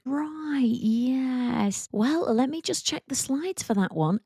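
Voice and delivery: flustered presenter. Retold or imitated. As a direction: bluff your way, checking slides. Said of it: "being like".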